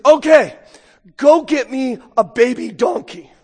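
a loud, hammed-up order for a donkey; loud mock command. Preacher voicing Jesus ordering the disciples, in a loud mock command. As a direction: bark it out like a brash king.

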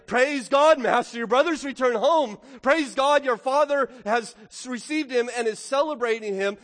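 a servant breathlessly shouting praise to his master; joyful servant. Acted. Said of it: "he said to him".

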